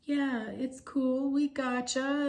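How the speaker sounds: slow and high, easygoing surfer reassurance; chill surfer dude. Retold or imitated. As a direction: float it out like nothing matters. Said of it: "And he's going".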